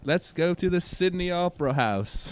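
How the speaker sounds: jokey Australian character voice; Australia Jack voice. Retold or imitated. New podcast character Australia Jack, mock Aussie accent. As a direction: play the goofy Aussie hero. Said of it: "Australia Jack".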